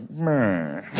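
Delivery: put-on drawn-out old-timey Man; old radio announcer. Announcer before Jackie bats, old-timey voice. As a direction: sigh it like a forties announcer. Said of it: "You can hear the announcers like".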